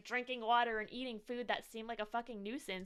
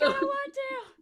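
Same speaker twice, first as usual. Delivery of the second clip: whiny refusal; 12 semitones higher, 7 dB louder than usual. Retold or imitated. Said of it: "you're like".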